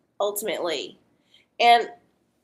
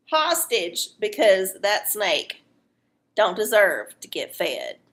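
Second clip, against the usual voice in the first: normal talking voice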